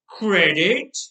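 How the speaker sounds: loud mocking scoff; laughing scoff. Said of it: "the knife man laughed".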